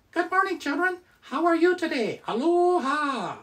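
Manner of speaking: high squeaky puppet voice; squeaky ventriloquist dummy. Pretend. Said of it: "it's Coconut Monkey".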